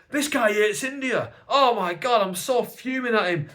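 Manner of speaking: high, loud mock-outraged troll voice; hammed-up fuming commenter. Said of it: "who troll me to be like".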